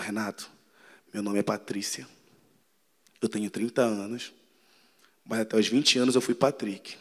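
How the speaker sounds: low and very soft, almost whispered; soft confession. Retold or imitated. Patrícia confessing her past to Renato, hushed. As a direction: confess it quietly, close to the ear. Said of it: "fala baixinho aqui".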